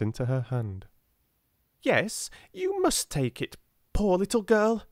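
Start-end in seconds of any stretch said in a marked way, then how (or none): 1.8-4.8 put-on high little boy, firm and insistent; a small boy who won't take no; stout insistence. A narrator's reading. Little boy insists the poor girl takes his sixpence. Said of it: "he insisted stoutly".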